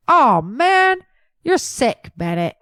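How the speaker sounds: hoog en luider gezeurd; whining. Acted. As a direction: jammer het vies uit. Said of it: "He whined".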